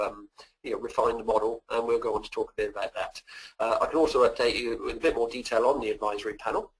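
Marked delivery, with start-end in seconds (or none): none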